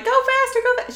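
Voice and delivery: high-pitched frantic urging; imitated panicked yelling. Friend acting Bulma shaking Krillin during a chase, panicked yelling. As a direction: mimic someone scared, yelling to hurry. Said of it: "shaking him, yelling, like".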